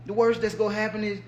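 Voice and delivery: normal talking voice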